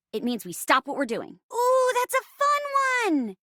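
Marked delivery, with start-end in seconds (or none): 1.5-3.4 high, drawn-out delighted coo. Acted. Actor relishing a partner's fun safe word, delighted. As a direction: savour it in a high, excited voice.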